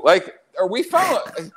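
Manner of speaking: ordinary talk, nothing marked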